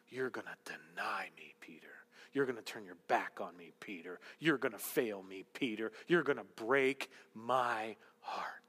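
Jesus whispering to Peter that he will fail; hushed whisper. Acted. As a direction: breathe it like a sorrowful secret. Jesus voiced foretelling denial.

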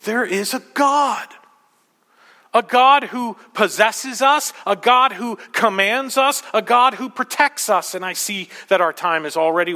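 high, emphatic preacher's declaration; impassioned preaching. Acted. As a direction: proclaim it in measured, ringing phrases. Preaches at full force.

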